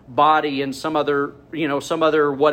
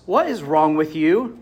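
ordinary talk

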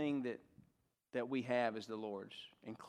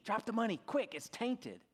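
higher, urgent hushed plea; frantic urging; 8 semitones higher than usual. Retold or imitated. Mother urges her son at the offering plate, frantic. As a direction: press him urgently, half-whispered. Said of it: "whispered in a frantic tone".

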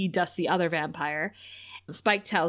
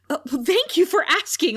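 higher, louder courteous thank-you; polite gushing reply; 8 semitones higher, 4 dB louder than usual. Retold or imitated. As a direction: beam back a gracious answer. Imitating a Chinese person answering.

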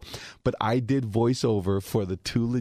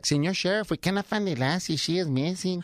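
put-on high, lightly accented Mexican boy actor; kid's Mexican accent; 7 semitones higher than usual. Kid actor to the sheriff, higher mock Mexican accent. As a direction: play the little Mexican kid on Lassie in a higher voice. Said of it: "And he's like".

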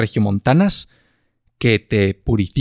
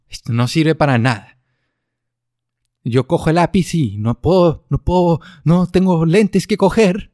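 mock Spain Spanish; 5 semitones higher than usual. Acted Spaniard skit at optometrist.

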